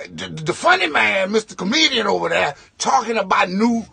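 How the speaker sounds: ordinary talk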